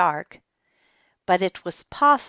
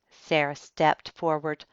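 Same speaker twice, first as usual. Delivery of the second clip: ordinary delivery, nothing marked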